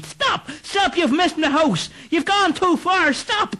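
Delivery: very high frantic shouts; shouting stop. Acted. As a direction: yell at the runaway horse. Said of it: "with Clancy shouting".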